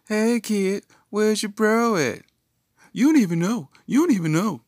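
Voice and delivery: high, loud heckling yell; mock calling out. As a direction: holler it high like teasing a kid. Acted heckling call to a kid.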